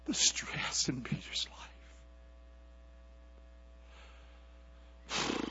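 hushed whisper; preacher whispering the point to the church. Acted. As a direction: lean in and whisper it.

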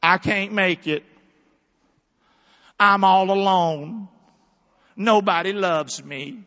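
slow, forlorn lament; mock lonely lament from the psalm's start; mock despair. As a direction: act out the psalmist's misery lightly. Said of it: "At the beginning of the psalm".

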